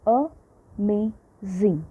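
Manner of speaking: "Amazing" very slowly, split a-ma-zing; drawn-out teacher's syllable count on "Amazing". Shown as a demonstration. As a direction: break "Amazing" into three separate beats with pauses.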